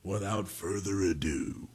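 slow, drawn-out big announcer build-up; announcer intro. Acted. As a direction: hype the segment like a radio promo. Announces his segment like a promo.

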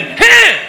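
high, loud martial-arts "heh"; sharp punch cry. Acted. Imagined perfect punch, acted out.

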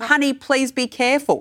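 lifted, sing-song caution; gentle warning. Retold or imitated. Parent gently cautioning someone else's child, lilting. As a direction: sweetly warn a child off. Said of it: "a gentle way of doing it".

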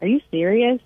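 mock incredulity, pitch lifted; imitated disbelief.

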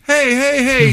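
put-on high clamoring kid calls; clamor like an eager kid. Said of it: "little kids were acting like".

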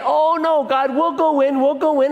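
high, pleading mock whine; mock complaining. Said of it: "they grumble and complain".

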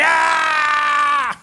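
put-on long, high, loud toddler squeal; sustained mock screech; imitated child's scream. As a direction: shriek like a kid running away.

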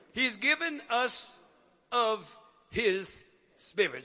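very slow, spaced-out scripture reading; deliberate reading, dropping low on the last word; word-by-word emphasis. Real, not acted. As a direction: stretch each word and pause between them.

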